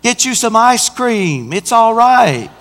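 high, sing-out coaxing of an angry driver; exaggerated soothing retort; mock placating. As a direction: coax them like an upset child, hammed up. Placates the screaming woman driver.

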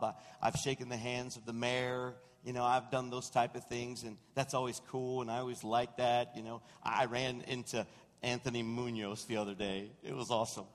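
normal talking voice

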